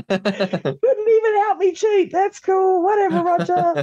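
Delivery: high, slow, laughing, near-crying whine; half-sob, half-laugh complaint; laughing mock sulk. Teasing the host who walked her out, a laughing mock whine. Joking tearful complaint to Roger.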